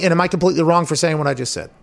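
normal talking voice

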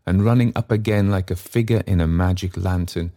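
ordinary delivery

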